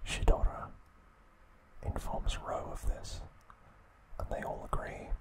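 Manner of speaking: normal talking voice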